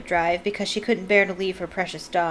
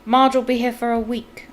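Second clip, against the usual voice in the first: snarl; 4 semitones higher than usual. A narrator's reading. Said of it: "Uncle Vernon snarled".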